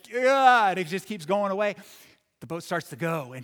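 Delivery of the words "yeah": cheering. Retold or imitated. Cheering the ball on.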